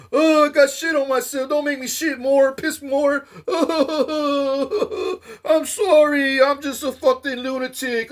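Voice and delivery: the beaten man begging the crowd to stop, half laughing, half crying; whimpering lunatic. Acted. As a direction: beg and whimper like a broken lunatic.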